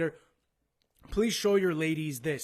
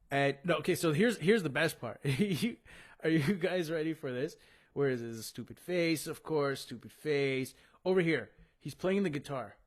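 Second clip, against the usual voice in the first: ordinary talk, nothing marked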